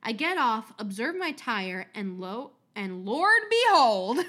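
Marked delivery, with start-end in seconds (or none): 2.8-4.2 slow, louder southern drawl on "Lord behold"; hammed-up Southern preacher exclamation; southern accent. Shown as a demonstration. Showing off a southern accent to the host, slow and loud. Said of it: "That's my southern accent".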